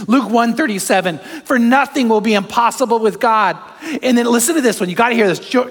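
loud, high, driving sermon voice; full-force preaching. Acted. Pastor preaching God's power to the congregation, loud and driving. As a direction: preach it out to the whole room.

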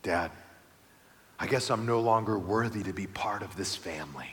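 soft, low and heartbroken; quoting a crushed little son; sad child voice. Retold or imitated. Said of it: "he says".

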